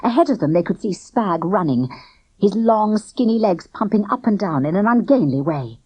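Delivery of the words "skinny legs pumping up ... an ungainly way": plain reading voice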